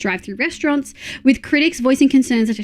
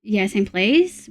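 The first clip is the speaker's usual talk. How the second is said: ordinary talk, nothing marked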